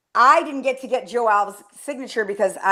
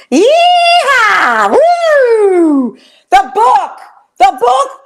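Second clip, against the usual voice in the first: much higher, much louder excited shouting; wild excitement; 13 semitones higher, 7 dB louder, much slower than usual. Real, not acted. Host shouting wildly to co-host over the book.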